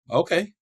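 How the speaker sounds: OKAY rising high, like a question; retold tentative agreement. Retold unsure agreement to friend's offer.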